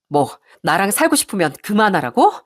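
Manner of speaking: incredulous question with a rising end; indignant echo. Acted. Wife repeats her husband's ultimatum back at him, indignant echo. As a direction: throw the words back as a challenge. 아내가 남편 말을 되받아 따짐.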